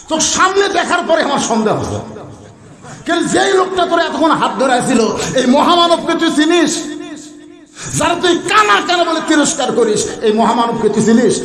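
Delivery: high-pitched, outraged reproach; angry scolding. Acted. Companion rebuking the shepherd over Osman, angry scolding. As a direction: scold in a high shouted chant.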